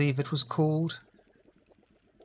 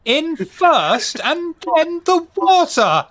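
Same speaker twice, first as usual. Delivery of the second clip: high, slow, appalled British voice; be scandalized about the tea; outraged British; 8 dB louder, much slower than usual. Acted.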